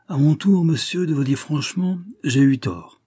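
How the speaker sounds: earnest exclamation, lifted voice; exclaimed. A narrator's reading. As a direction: exclaim it openly, starting high and strong. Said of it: "s'écria-t-il".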